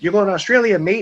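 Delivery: teasing Australian twang, loud and high; mock Australian. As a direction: announce it like a cheeky Australian mate. Brother announces the Australia trip.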